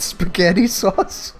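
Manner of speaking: normal talking voice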